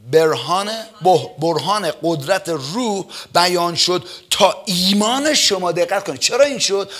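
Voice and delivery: slow, stressed preaching of the verse; emphatic verse reading. Acted. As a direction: lean on each phrase slowly. (موعظهٔ آهسته و پرتأکید)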